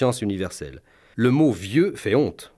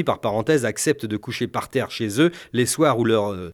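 ordinary talk

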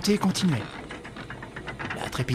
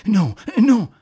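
loud, high cry of refusal; revolted cry; 8 semitones higher, 9 dB louder than usual. Acted. Stéphane revolted at the idea of Véronique's death, crying out. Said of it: "s'écria-t-il révolté".

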